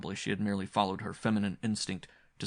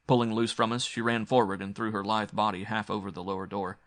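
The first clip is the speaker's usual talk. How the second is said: plain reading voice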